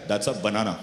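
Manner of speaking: put-on banana said the Desi way, in a thick Desi accent. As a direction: Desi dad naming the fruit in a thick Desi accent. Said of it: "with a very thick Desi accent".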